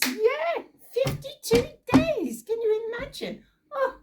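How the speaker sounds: high, loud amazed exclaim. Real, not acted. Preacher to children, marvelling loudly at the answer. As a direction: wow them with the surprising answer.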